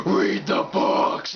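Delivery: demonic whispered rasp, drawn out; Exorcist voice. Retold or imitated. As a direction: croak it slowly like someone possessed. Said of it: "the Exorcist voice comes out".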